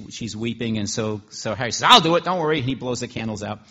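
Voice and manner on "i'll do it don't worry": much louder, higher, hearty; loud reassurance. Acted. Cook offering to blow the candles, loud and hearty. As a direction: jump in boldly to help. Harry blows the candles for her.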